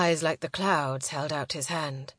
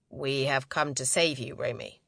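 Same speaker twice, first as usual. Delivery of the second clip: lower, lightly acted man's voice; a hint of a commander's lower register; lightly voiced male leader; 6 semitones lower than usual. A narrator's reading. Man telling Romy they came to save her, lightly voiced lower.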